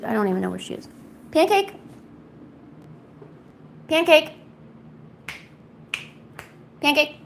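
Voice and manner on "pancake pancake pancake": high rising calls for the cat; calling the cat. Real, not acted. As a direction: call the kitty sweetly and high.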